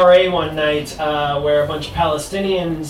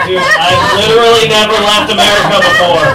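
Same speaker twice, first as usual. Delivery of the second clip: raised, louder voice of comic disbelief; loud mock exasperation; 3 semitones higher, 8 dB louder than usual. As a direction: blurt it out loudly for laughs. Acted quote of own astonished reaction.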